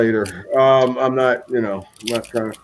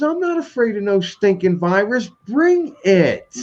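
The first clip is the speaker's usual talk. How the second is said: put-on feisty old lady; 8 semitones higher than usual. Retelling the feisty old woman.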